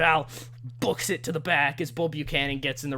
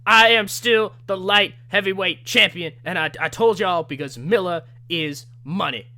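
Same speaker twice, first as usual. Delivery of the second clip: loud, higher boastful shout; cocky wrestler promo; 3 semitones higher, 8 dB louder than usual. Retold or imitated. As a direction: brag to the whole arena. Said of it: "Ernest Miller says".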